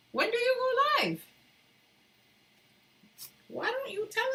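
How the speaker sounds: way up high, teasing and insistent; squealing playful demand. Real, not acted. Streamer squealing at a viewer who never goes live. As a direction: pester a chat friend in a squeaky voice.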